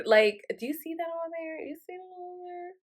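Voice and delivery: high, hushed, urgent-sounding aside; retold secretive murmur. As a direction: point it out discreetly, fading to almost nothing. Discreetly pointing out scene to Eric.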